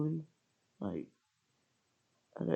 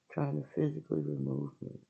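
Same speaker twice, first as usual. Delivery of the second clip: ordinary delivery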